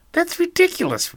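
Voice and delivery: very high loud protest; indignant outburst. Acted. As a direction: fire back, outraged. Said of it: "replied Lisa".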